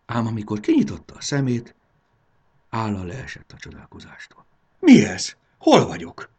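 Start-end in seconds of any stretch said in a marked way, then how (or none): 4.8-6.3 startled cry. A narrator's reading. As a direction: cry out, completely disoriented. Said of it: "kiáltotta". (meghökkent kiáltás)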